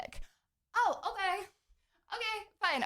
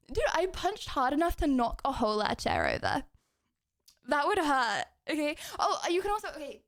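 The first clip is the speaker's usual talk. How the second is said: ordinary talk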